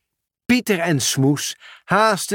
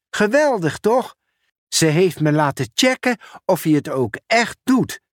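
higher, eager colleague voice; a friendly raised voice with urgent news; Ben the sorter; 4 semitones higher than usual. Acted. Ben calls Pieter about an urgent parcel, higher and eager.

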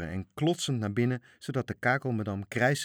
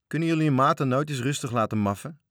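grumpy, louder complaint; annoyed; 5 semitones higher, 7 dB louder than usual. Acted. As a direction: grumble at being woken. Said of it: "riep hij verstoord". (knorrige Frits klaagt verstoord)

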